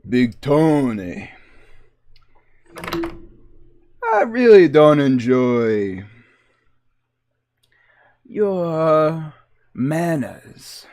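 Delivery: slow, drawn-out mobster menace. Pretend. Streamer playing mobster confronting Big Tony, slow threat. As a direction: play a mob heavy, taking his time. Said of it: "did I just walk into a mob bar?".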